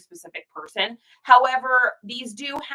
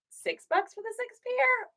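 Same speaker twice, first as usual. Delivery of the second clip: mock innocent counteroffer; 13 semitones higher, 6 dB quieter than usual. Haggling counteroffer, quoted self.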